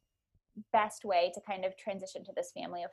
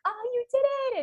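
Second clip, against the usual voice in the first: delighted praise. Retold or imitated. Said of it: "she was like".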